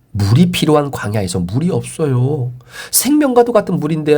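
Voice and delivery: usual speaking voice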